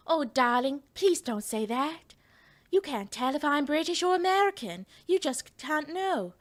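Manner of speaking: put-on high, slow old-movie transatlantic drawl; transatlantic accent. Shown as a demonstration. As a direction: camp it up like a 1940s film star in a transatlantic accent. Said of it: "the transatlantic accent, where it goes like".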